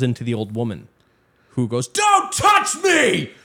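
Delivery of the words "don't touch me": very high, drawn-out screech; old woman's shriek. Retold or imitated. Old woman snaps at fallen kid.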